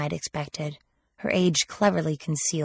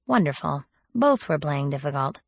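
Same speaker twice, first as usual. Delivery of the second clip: usual speaking voice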